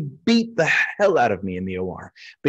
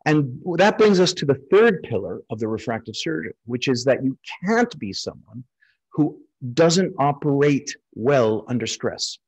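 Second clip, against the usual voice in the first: ordinary talk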